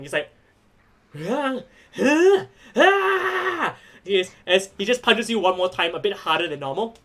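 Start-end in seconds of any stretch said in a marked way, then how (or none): 1.3-3.8 high-pitched, puzzled wordless noises; questioning "ah", climbing to a high wail; confused cries. Acted. Game master voicing confused Vincent between punches, high confused cries. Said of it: "like, confused for a second".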